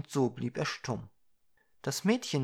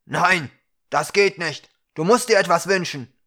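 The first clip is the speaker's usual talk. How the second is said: loud, raised, insistent refusal; stern objection; 4 semitones higher, 11 dB louder than usual. A narrator's reading. Ukra refusing to let the dwarf off, loud and insistent. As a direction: object forcefully, drawing out the no.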